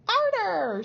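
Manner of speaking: high, loud screech; shriek. A narrator's reading. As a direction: shriek it out from the corner. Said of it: "shrieked Missus Cratchit".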